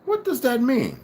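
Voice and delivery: high-pitched, bewildered questions; puzzled tearful wife. A narrator's reading. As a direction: ask it high, without a clue. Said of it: "she asks while she blows her nose".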